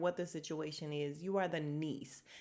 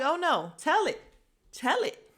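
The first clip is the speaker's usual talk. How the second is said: louder, higher, egging him on; eager urging; 7 semitones higher, 5 dB louder than usual. Pretend. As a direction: come on, spill it. Host urging him to tell.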